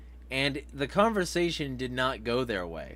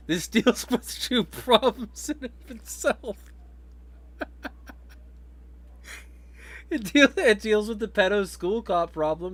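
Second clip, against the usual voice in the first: laughing; 9 semitones higher than usual. Real, not acted. Laughing through news commentary.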